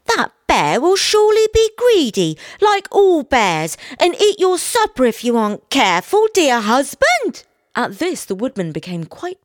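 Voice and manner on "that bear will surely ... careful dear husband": high, loud, scolding wife voice; high nagging wife; warning wife. A narrator's reading. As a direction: warn him in a high-pitched, raised voice. Wife warns husband about bear.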